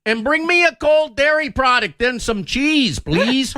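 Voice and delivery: high, slow hammed-up Midwest accent; mock Minnesotan voice. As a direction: play a dairy-loving Minnesotan, up high. Mocking Minnesotan caller.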